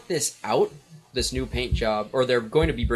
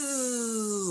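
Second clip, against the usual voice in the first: high buzzing spin noise; buzzing imitation; 11 semitones higher, 6 dB quieter than usual. Shown as a demonstration. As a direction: buzz like a spinning cylinder. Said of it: "Sorry, what does it sound like?".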